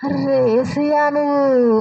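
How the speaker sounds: slow, drawn-out dawning recognition; sudden realisation. Retold or imitated. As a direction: act out the moment it finally clicks. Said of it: "అప్పుడు వెలిగింది bulb ఆమెకి". (ఒక్కసారిగా గుర్తుపట్టిన ఆశ్చర్యం, సాగదీస్తూ)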